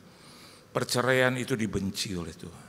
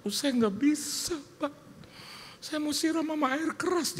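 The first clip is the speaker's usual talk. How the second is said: much higher put-on woman's voice; upset wife. Wife tells pastor she'll throw acid, high voice. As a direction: pitch it way up as the desperate wife.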